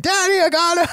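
hammed-up yell for dad; mock yell. The heroine yelling to her dad, hammed-up high yell. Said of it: "Nancy's already yelling".

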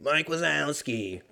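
slow, drawn-out imitation of Roz's grumpy drone; Monsters Inc. receptionist. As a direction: drag it out like the bored monster clerk. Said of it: "like the fat bitch from Monsters Incorporated".

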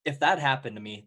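ordinary talk

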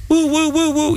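high sing-song siren wail; a playful high siren noise; mock siren. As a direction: imitate a police siren sweeping up high. Imitating police siren.

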